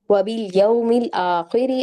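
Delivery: वबिल यौमिल आखिरी recited slowly, with a long आ in आखिरी; slow recitation. Shown as a demonstration. Teacher recites the verse slowly, holding its long vowel. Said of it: "आ पे खड़ी जबर है".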